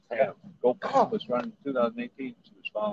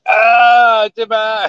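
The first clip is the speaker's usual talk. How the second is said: pained yell; 11 semitones higher, 16 dB louder, much slower than usual. Real, not acted. Spray got in his eye.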